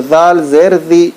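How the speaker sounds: slow, chanted spelling recitation; Quran primer recitation. Shown as a demonstration. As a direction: chant each syllable slowly like a Quran class.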